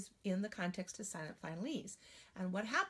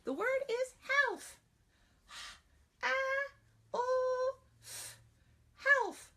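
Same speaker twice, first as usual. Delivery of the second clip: put-on high, loud childlike dictation; child's voice; 5 dB louder, slower than usual. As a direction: read it back like a young pupil. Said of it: "Dictate it back to me".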